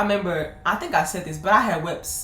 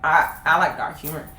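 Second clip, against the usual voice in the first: normal talking voice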